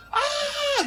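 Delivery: mock scream, very high; mom's scream. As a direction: imitate mom's joyful shriek from the stands. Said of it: "just screaming".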